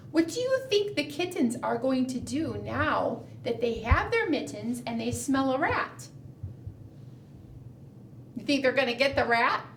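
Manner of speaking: normal talking voice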